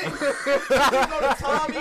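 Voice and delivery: normal talking voice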